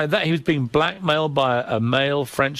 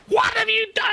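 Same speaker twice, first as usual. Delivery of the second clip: high screeching shriek of fury; retold scream. Wife screams down the phone at the columnist, shrieking high. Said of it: "come screaming".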